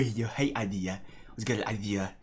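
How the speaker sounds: ordinary talk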